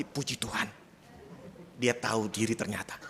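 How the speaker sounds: quietly grateful they leave; relieved inner thought. Retold or imitated. (lega dalam hati, lebih pelan)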